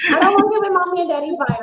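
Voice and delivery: high, fast pretend kid; hammed-up childish complaint; mock child. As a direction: whine it like a small kid. Continuing mock child bit.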